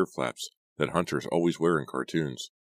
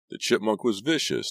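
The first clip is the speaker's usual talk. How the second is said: choking out words; 7 semitones higher than usual. A narrator's reading. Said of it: "Todd choked".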